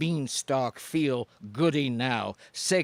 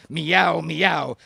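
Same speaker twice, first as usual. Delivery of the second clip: loud playful meow twice; cat meow; 5 dB louder than usual. Acted. Poet as a boozing cat, loud mock meow.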